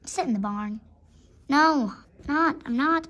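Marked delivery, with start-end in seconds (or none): 1.5-3.1 loud refusal. Acted. Acted refusal of an order.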